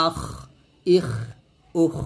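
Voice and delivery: "আখ, ইখ, উখ" with a thick throaty খ; heavy খ. Shown as a demonstration. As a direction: end each of "আখ, ইখ, উখ" on heavy back-of-mouth friction. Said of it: "তো এখানেও মোটা হবে".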